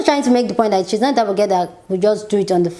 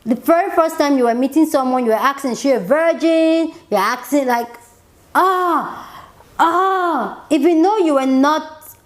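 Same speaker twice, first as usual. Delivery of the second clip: exasperated outburst; 7 semitones higher than usual. Retold or imitated. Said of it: "And I'm like".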